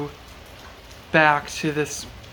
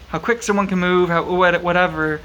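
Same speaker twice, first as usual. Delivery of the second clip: ordinary delivery, nothing marked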